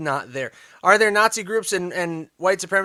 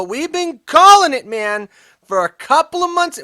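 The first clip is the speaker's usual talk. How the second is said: emphatic; 9 semitones higher, 7 dB louder than usual. Real, not acted. Repeated emphatic claim.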